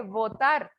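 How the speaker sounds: votar starting with teeth on lip; labiodental V. Shown as a demonstration. Teacher likening it to vaca, pronunciation demo. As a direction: begin votar with a buzzed V. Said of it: "es con B labiodental".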